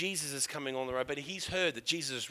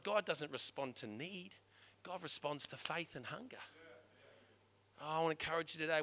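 ordinary talk, nothing marked

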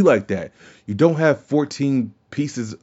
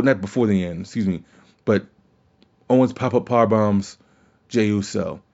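ordinary talk, nothing marked

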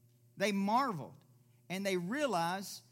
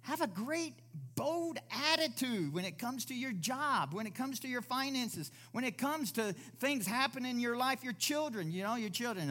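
higher and louder preaching push; full-force preaching; 8 semitones higher, 4 dB louder than usual. Real, not acted. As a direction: fire up the congregation. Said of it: "preaching at full force".

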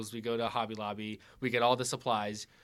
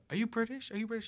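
high, softer mock cashier questions; curious cashier; 10 semitones higher, 3 dB quieter, faster than usual. Son imitating cashiers asking about his mother, curious high voice. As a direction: ask it high and light, imitating a nosy stranger.

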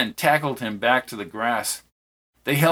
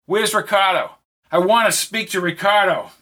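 loud, higher demanding shout; yelled; 6 semitones higher, 3 dB louder than usual. A narrator's reading. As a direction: shout for Ricardo, desperate. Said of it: "yelled Peter".

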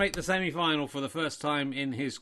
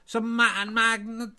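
higher put-on Irish voice; mock Irish accent; 8 semitones higher, 4 dB louder than usual. Irish me corrected by Richard, back to a mock Irish accent. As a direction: try for Irish again. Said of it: "Not Welsh, you're Irish".

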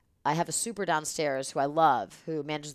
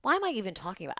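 usual speaking voice

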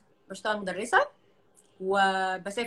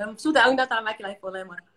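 bad connection; 5 dB louder, much faster than usual. Real, not acted. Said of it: "بس أنا صوتك بيقطع".

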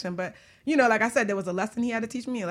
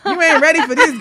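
excited hype; 7 semitones higher, 10 dB louder than usual. Real, not acted. Hyping up a juicy episode.

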